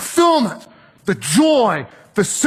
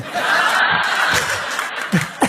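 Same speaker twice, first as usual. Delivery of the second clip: laugh. Real, not acted. Laughing at own story.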